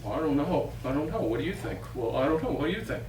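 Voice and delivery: quiet unsure mumbling; unsure knights. Acted. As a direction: knights dithering to each other. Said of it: "sort of look at each other".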